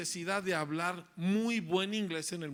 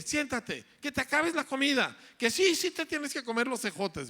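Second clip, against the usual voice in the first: higher, louder scolding mom at the dinner table; nagging parent; 9 semitones higher, 4 dB louder than usual. Retold or imitated. Parent ordering child to finish dinner, loud nagging. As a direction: bark the everyday orders like a fed-up parent. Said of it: "Eso es lo que oye".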